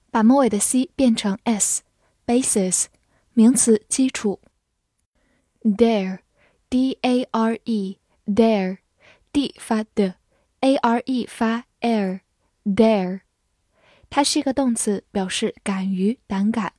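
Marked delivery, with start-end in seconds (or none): none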